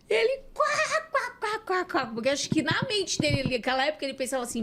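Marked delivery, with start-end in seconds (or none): 0.1-2.1 high cackling quá quá quá laugh; imitated laugh. Jesus laughing at her pastor remark, high mock cackle. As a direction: mimic his amused laughing at her. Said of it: "E ele ria".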